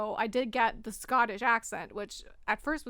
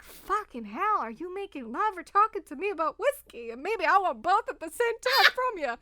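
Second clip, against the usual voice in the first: higher, flustered mock outburst; worked-up reaction; 6 semitones higher, 5 dB louder than usual. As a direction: act out being hot and bothered. Acted reaction to sultry whiskey talk.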